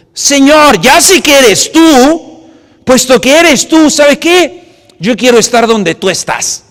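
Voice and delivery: loud, high plea across the water; calling out. Acted. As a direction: beg to come out to him. Peter calls to Jesus.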